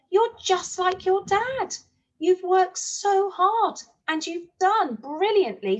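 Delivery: proud praise. Acted. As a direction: gush with pride over your boy. Mum praises her son proudly.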